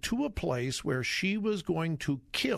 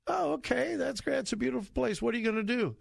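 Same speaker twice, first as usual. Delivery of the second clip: cheery driver voice; 8 semitones higher, faster than usual. Acted.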